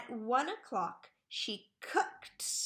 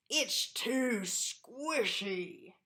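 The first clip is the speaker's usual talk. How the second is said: slow whispered mouthful complaint; mouth full; much slower than usual. A narrator's reading. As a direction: mumble it slowly while chewing. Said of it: "talking with his mouth full".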